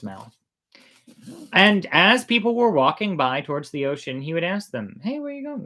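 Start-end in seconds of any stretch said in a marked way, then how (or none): 5.0-5.6 sly greeting. Acted. Trickster greets passing villagers.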